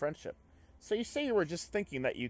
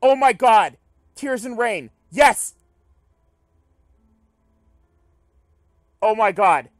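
shouting, pitch shooting way up; real excited outburst; 13 semitones higher, 18 dB louder than usual. Real, not acted. Player spots a Blade Runner reference, excited shouting. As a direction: yell it in thrilled disbelief.

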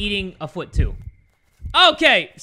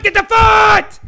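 shouted at the top of the voice, high; frantic gamer shout; urgent shout; 13 semitones higher, 12 dB louder, slower than usual. Real, not acted. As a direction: yell the order at the screen. Urging character during game.

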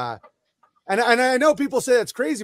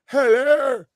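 a hint of a British accent, a little higher and louder; mock British accent; 5 semitones higher, 4 dB louder, much slower than usual. Imitating Helen Keller greeting an audience, light British accent. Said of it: "it's all global as shit".

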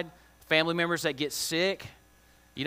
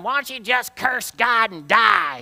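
louder, higher, slow bitter yell at her husband; wife yelling; 6 semitones higher, 6 dB louder than usual. Retold or imitated. Job's grieving wife scolding him, bitter raised yell. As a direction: fling it at him, fed up. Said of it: "his wife's yelling at him".

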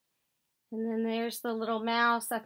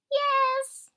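muffled little voice; 13 semitones higher, 5 dB louder than usual. A narrator's reading. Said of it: "came a muffled voice".

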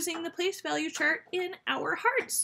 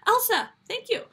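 higher, louder thanks to a pupil; pretend delighted thanks; 8 semitones higher, 5 dB louder than usual. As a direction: call out thanks as if a pupil just answered. Pretends a viewer called out answer.

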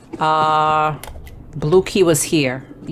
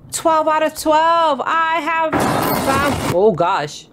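triumphant; 7 semitones higher, slower than usual. Real, not acted. Found all cheese.

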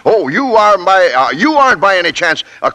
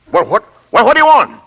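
angry shout; 7 semitones higher, 4 dB louder than usual. Acted. Boss snapping at the interruption.

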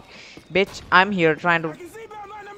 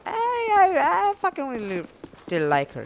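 hammed-up fake sob, pitched way up; overdone pretend weeping; mock crying; 11 semitones higher than usual. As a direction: wail it like a heartbroken cartoon. Mock sob about the girl.

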